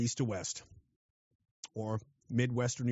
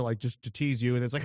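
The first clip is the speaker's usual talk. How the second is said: usual speaking voice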